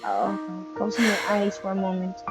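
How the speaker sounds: ordinary talk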